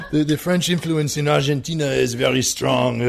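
laughing, put-on French accent; jokey French accent through a laugh; mock French accent. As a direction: declare it in a hammed-up French accent.

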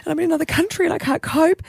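high, tearful retold pleading; mock-weepy worried parent; imitated tearful crying. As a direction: act out fretting through tears, pitched high. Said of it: "tearing and crying".

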